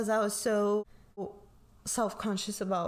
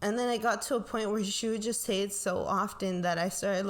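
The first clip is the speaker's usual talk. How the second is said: ordinary talk, nothing marked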